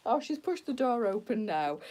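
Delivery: ordinary talk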